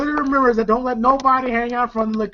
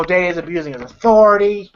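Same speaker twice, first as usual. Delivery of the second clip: ordinary talk, nothing marked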